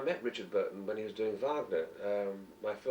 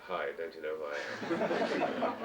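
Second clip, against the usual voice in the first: hammed-up starry celebrity; mock star diva; 6 semitones lower, slower than usual. Shown as a demonstration. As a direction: play the vain celebrity demanding recognition. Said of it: "I don't actually go on holiday with my dark glasses and say".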